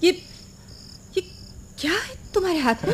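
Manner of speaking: high, hushed, halting alarm; falter, then rush the frightened question; panicky. Acted. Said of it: "अरे घबराओ नहीं".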